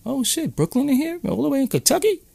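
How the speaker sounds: high, hushed mock amazement; imitated surprise. Imitating Kentucky people stunned to hear of Brooklyn, mock surprise. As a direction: softly squeal it like an astonished crowd. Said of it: "your people be like".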